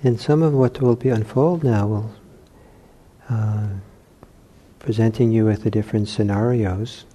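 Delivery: soft, unhurried meditation voice with long pauses; guided meditation. Real, not acted. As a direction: ease them gently into relaxation, leaving long silences. Leads guided meditation exercise.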